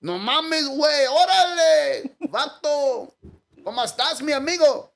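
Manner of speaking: put-on high, sing-song Mexican accent; a lilting, singsong Mexican accent. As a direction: sing the words like a Mexican guy. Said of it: "sing their words like".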